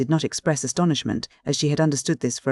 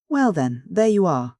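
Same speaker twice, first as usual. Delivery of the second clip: bright, quick, a touch louder; a mildly lively cry; 5 semitones higher, 4 dB louder than usual. A narrator's reading. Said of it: "in her liveliest tone".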